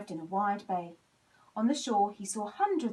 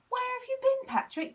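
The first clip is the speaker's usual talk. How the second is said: high, loud eager question; excited children; 6 dB louder than usual. A narrator's reading. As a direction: call it out excitedly. Whole class greets Patrick.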